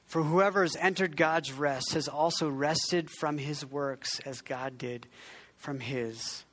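measured, reverent scripture reading. Acted. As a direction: read the verse slowly and solemnly. Reads scripture.

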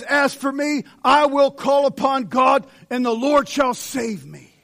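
loud, high preaching opener; fervent pulpit delivery. Acted. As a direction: proclaim the psalm with full force. Preacher opening with scripture.

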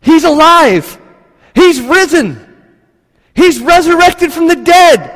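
high and loud, bursting with news; excited announcement. Acted. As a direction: shout the good news through the door. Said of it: "They're screaming. They're shouting".

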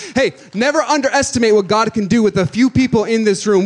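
loud and high, punching never; full-force pulpit cry; preaching shout. Acted. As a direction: proclaim it full voice. Shouted peak of the sermon.

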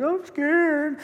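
put-on high, small frightened child voice; scared little girl. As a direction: squeak it like a scared little daughter. Daughter voiced during storm.

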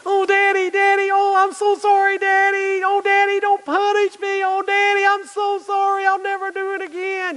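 very high, whiny mock pleading; hammed-up little kid begging Daddy for mercy; child's crocodile tears. Caught child begs Daddy not to punish, mock tearful pleading. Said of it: "big old crocodile tears".

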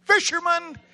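high, loud burst; shouted answer. Real, not acted. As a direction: belt out the answer to your own question. Shouts answer to own question.

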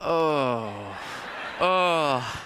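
fake sick kid. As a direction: whine that you feel sick to get a toy too. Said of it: "And he goes".

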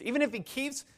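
high, doubtful questioning; acted objection. Preacher voices a wounded spouse doubting forgiveness, high objection. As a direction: protest as the hurt spouse.

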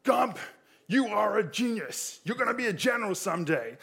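high-pitched, emphatic drill-sergeant voice. Acted. Drill sergeant praising Gump before the squad, high-pitched military shout. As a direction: proclaim it like an army drill sergeant. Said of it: "the drill sergeant goes".

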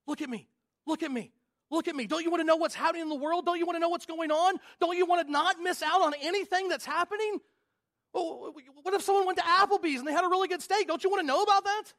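the phone begging for attention, urgent and tempting; the phone's nagging voice. Acted. The pastor voices his phone tempting him, in an eager, nagging tone. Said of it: "It keeps saying".

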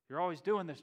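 exasperated. Retold or imitated. Fed-up disciples voiced, with sigh.

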